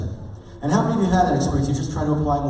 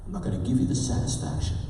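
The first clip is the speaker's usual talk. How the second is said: sulky mutter; 7 dB quieter than usual. Retold or imitated. Sulking hypnotized guy retold.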